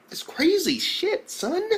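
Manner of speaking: slow, high-pitched amazement; stunned disbelief. Real, not acted. Reviewer stunned by a body hung at school, high-pitched disbelief. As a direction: draw it out high, totally floored.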